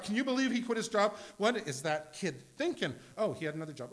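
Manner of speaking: loud, fast, high fretting parent; mock outrage. Father fretting aloud about his son's job, loud high alarm. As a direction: rant about the foolish kid in alarm. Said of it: "We're freaking out all the time".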